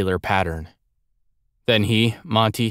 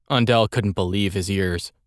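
plain reading voice

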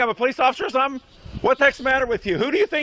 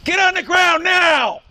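loud, slow, barked police command; shouting commands; 4 semitones higher, 4 dB louder, slower than usual. Real, not acted. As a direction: yell it as an order, slow.

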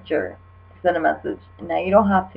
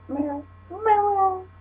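high squeaky oh my god, climbing; disbelief; 13 semitones higher, 6 dB quieter than usual. Real, not acted. Laughing disbelief at a ridiculous message. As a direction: gasp it out, higher the second time.

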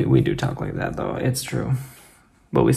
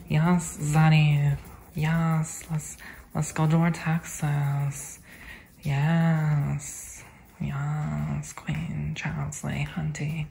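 dramatic sass; 6 dB quieter, much slower than usual. Pretend. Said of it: "I'm just being dramatic".

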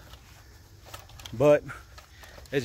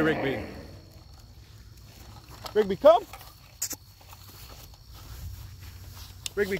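calling a dog; 8 semitones higher than usual. Real, not acted. Calling dog back, loud high.

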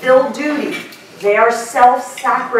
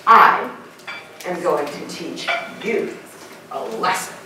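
moral lecture; 7 semitones lower, 4 dB quieter than usual. Retold or imitated. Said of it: "which is".

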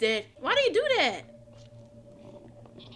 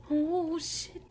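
ordinary talk, nothing marked

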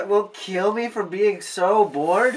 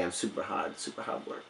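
fading to a whisper; 14 semitones lower, 16 dB quieter than usual. Acted. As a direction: drop to a breathy whisper by the end. Puppet trails off into whisper.

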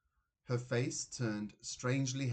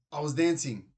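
sudden louder blurt; 3 semitones higher, 7 dB louder than usual. A narrator's reading. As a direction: blurt it out before you can think. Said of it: "he blurted out".

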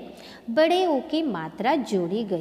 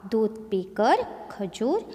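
normal talking voice

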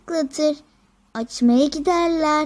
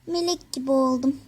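ordinary delivery

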